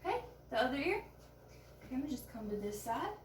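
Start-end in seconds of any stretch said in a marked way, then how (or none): 1.0-1.9 whispering. Shown as a demonstration. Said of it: "performing a whisper test".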